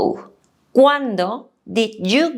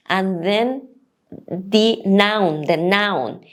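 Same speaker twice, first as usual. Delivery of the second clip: normal talking voice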